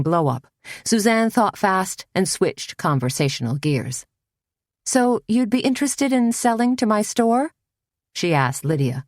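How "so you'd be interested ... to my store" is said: higher, breezy sales chat; light and breezy. A narrator's reading. Suzanne pretends to do business to calm things, breezy. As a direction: fake casual, as if nothing is wrong. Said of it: "make her voice sound light and breezy".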